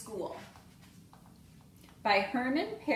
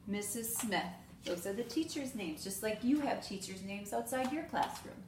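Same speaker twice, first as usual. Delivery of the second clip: ordinary talk, nothing marked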